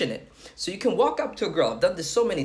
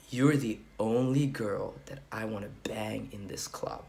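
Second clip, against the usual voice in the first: forceful sexual opener; 6 semitones lower, 8 dB quieter than usual. Shown as a demonstration. Man delivers opener once she has promised, low intense come-on. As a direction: drop low and quiet, every word deliberate. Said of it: "now you can really say it with".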